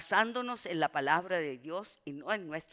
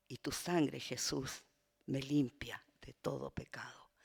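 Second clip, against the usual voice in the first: hushed prayerful whisper; 3 semitones lower than usual. Real, not acted. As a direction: whisper it to the Lord. Closing a spoken prayer.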